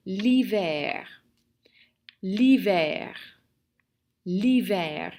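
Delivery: l'hiver said firmly, falling at the end; model pronunciation. Shown as a demonstration. French teacher has the class repeat, l'hiver modelled firmly. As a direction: model l'hiver clearly for learners, pitch dropping to finish. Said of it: "Répétez, s'il vous plaît".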